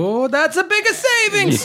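high, hammed-up Italian cheer; mock Italian accent. Heckling the host's sale prices, mock Italian accent. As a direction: crow it in cartoon Italian.

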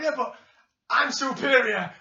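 loud, high mock boast; Angle. Host acting out the wrestlers' argument over the captain, boasting. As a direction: imitate a cocky retort, stretching the last words.

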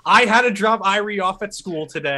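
high-pitched mock nagging; pissed-off wife. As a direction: play an angry wife scolding her husband. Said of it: "she gets all pissed at him".

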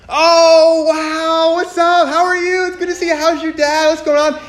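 very high, mock-starstruck greeting; imitating an overeager fan greeting someone; sudden gushing welcome. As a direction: gush in an exaggerated high voice. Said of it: "You know, instant change, right?".

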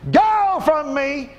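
slow, loud, drawn-out command; commanding shout. Real, not acted. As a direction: order it out with full force. Commanding cancer to leave.

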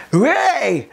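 high excited hey; wave cheer. Retold or imitated. Coach acts out fans starting the Mexican wave with a high excited cry.

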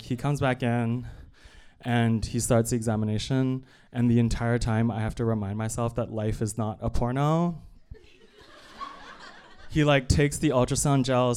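ordinary talk

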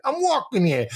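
high-pitched New York caricature; quick burst of cartoon New Yorkese; indignant mock New York accent; mock American accent. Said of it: "to do an American accent".

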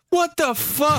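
high, squealing shocked yell. Retold or imitated. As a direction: shriek it in disbelief. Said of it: "I'd be like".

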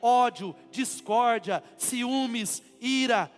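each sin punched out loud; emphatic list. Acted. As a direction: hammer every word of the list. Lists sins emphatically. (lista enfática)

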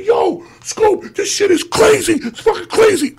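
high, thrilled phone call from a club. Retold or imitated. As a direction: imitate excited hollering over a crowd. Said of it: "He said".

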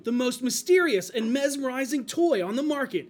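put-on loud, high, hyped kids commercial announcer; nineties toy ad voice. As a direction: sell it like a radical nineties toy ad.

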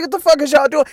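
high-pitched, incredulous scolding; exasperated protest. Retold or imitated. Acts out his protest once more.

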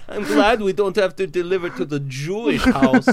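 high mock German accent; German Blitzen. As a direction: chime in as a German reindeer. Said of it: "Blitzen, what do you think?".

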